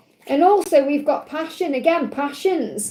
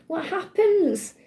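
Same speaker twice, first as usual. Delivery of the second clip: put-on higher, slow small child's question; inner child; 5 semitones higher, slower than usual. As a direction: ask it slowly, like a wondering little kid. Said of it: "his inner child going up to him saying".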